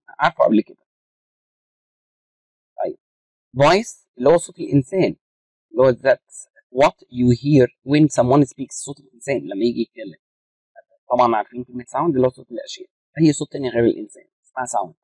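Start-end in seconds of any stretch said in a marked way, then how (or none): 5.9-8.7 slow dictation pace. Shown as a demonstration. Teacher reading a definition to students, slow dictation. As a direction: read out very slowly, word by word. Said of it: "teacher reads definition slowly".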